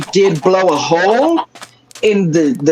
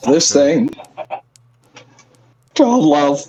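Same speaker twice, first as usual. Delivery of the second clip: voice cracking; 5 semitones lower than usual. Real, not acted. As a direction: voice breaks on "Called Love", pitch snaps. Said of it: "Oh, my voice cracked".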